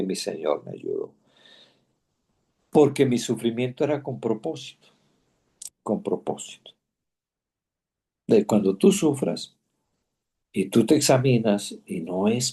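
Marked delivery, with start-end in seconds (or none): 5.8-6.7 whispered echo. Real, not acted. Echoing "with purpose.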